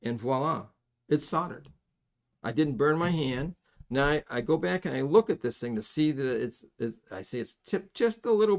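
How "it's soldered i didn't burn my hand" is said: ordinary talk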